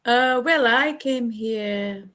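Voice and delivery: usual speaking voice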